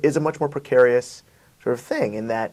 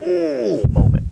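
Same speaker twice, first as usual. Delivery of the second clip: ooh moment; 9 semitones higher, 6 dB louder, much slower than usual. Retold or imitated. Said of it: "What's the screw your face moment?".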